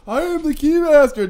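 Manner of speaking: very high, loud mock proclamation; hammed-up, high-pitched Keymaster announcement; Louis Tully. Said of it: "just come out and go".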